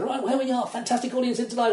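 high, bright compere patter; hammed-up club compere; mock MC voice. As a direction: play the cheesy host. Comedian doing MC patter.

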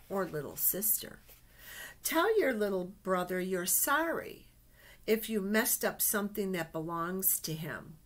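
lively picture-book storytelling voice; reading to children. Acted. Teacher reading a picture book to her class, storytelling voice. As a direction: read it like a storybook to little ones.

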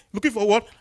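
usual speaking voice

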